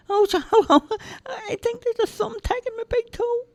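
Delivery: put-on high, childlike simple lad voice; cheerful high-pitched lad; Charlie's voice. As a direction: play the big innocent lug, high and eager. Charlie hurt by thumbtack.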